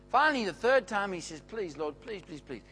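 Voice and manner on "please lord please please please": soft, pleading repeated please; quiet desperate begging. Acted. Elijah begging God for the boy's life, softly pleading. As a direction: beg softly, almost out of breath.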